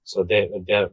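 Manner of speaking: normal talking voice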